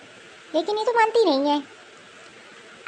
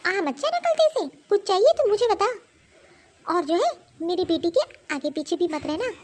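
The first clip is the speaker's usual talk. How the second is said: ordinary talk, nothing marked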